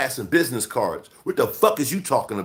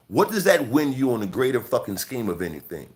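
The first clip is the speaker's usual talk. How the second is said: ordinary talk, nothing marked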